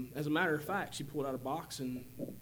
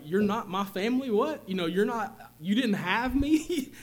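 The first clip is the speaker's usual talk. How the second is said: put-on high, loud, disbelieving kid's voice, laughing at the end; shocked child; 6 semitones higher, 8 dB louder than usual. Eight-year-old hearing he was adopted, high disbelieving cry. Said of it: "as an eight-year-old kid".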